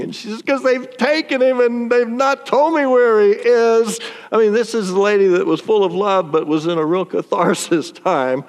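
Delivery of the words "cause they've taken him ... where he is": upset, tearful. Retold or imitated. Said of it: "and she's crying".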